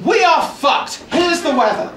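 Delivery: shouted doom then a cheery weather link; mock news anchor. Comic imagining the newsreader saying it, shouted then laughing. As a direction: newsreader yelling the bad news.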